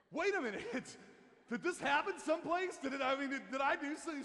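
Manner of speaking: high, loud, bewildered self-defence; flustered protest. Retold or imitated. Husband questions wife's accusation, flustered. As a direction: act out a baffled husband stammering for answers.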